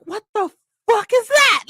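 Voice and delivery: high, stunned what the fuck; mock disbelief at the wall of heat; shocked exclamation. Recalling first step into Texas humidity, shocked.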